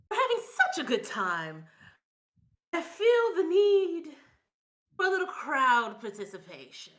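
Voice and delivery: loud, booming stage host; showman voice. Acted. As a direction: work the crowd like a showman. Host NPC addressing the crowd.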